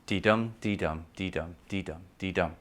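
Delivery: "dum" stressed, "dee" light, five even beats; da-DUM rhythm. Shown as a demonstration. Teacher chanting the iambic beat for the class. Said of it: "five alternate stresses".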